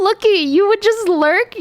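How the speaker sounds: ordinary delivery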